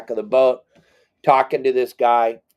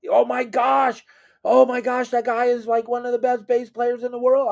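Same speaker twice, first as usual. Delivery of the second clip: squealing much higher, near shouting; wife raving in a high squeal; gushing; 12 semitones higher than usual. Retold or imitated. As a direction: fangirl over the bass player. Wife gushing about bassist.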